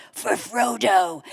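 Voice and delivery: slow, solemn movie-hero line; Viggo Mortensen. Retold or imitated. Viggo Mortensen's battle line, lightly imitated slow and solemn. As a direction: draw it out like a battle cry, lightly voiced. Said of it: "I hear Viggo Mortensen go".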